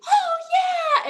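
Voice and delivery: very high, loud thrilled "oh yeah"; delighted child's squeal; excited kid squeal. Retold or imitated. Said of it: "Gordon's like".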